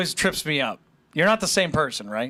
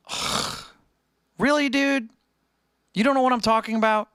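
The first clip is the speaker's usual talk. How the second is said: incredulous, higher-pitched scolding; exasperated kid; 7 semitones higher than usual. Retold or imitated. Annoying classmate scoffing at him at graduation, exasperated. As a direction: act baffled he missed the joke.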